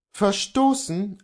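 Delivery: lightly voiced Kohlhaas, deliberate; Kohlhaas answers. Kohlhaas answering Luther, slow and deliberate. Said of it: "antwortete Kohlhaas".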